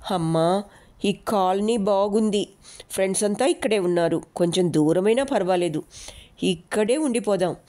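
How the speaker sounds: plain reading voice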